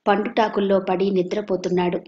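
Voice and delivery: usual speaking voice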